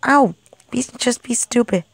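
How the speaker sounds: normal talking voice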